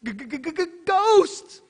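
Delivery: high, stammering cartoon fright on g-g-ghost; Scooby-Doo scared stutter. Preacher imitating Scooby-Doo's frightened stutter. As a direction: stutter it out like a terrified cartoon dog. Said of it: "you can almost hear 'em right now".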